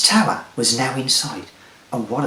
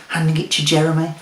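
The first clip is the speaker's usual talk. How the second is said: ordinary delivery, nothing marked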